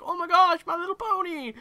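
high, loud mock squeal of delight; mock gushing fan. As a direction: ham up a gushing fan spotting a pony. Mimicking gushing pony fans.

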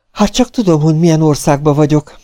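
high-pitched protest; indignant. A narrator's reading. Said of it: "méltatlankodott a szakállas".

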